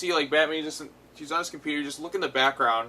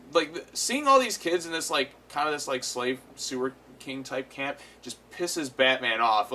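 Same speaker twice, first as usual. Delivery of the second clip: ordinary talk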